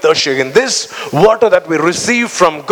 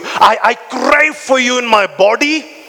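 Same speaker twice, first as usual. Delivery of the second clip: higher, fervent build; impassioned preaching; 4 semitones higher than usual. Acted. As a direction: pour it out, rising higher.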